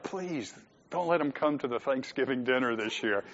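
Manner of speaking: a soft, mock-desperate little prayer; hushed mock plea. Congregation member quietly begging God to keep relatives away. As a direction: plead under your breath, half joking. Pleading about relatives at Thanksgiving.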